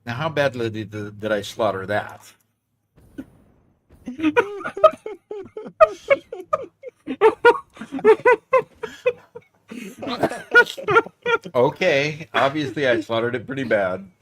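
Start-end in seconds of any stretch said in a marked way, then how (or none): 4.1-9.1 real burst of high laughter; high giggling laugh. Real, not acted. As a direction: crack up at yourself. Laughing at own bad accent.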